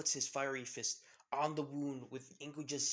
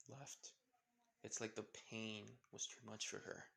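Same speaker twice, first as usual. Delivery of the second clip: usual speaking voice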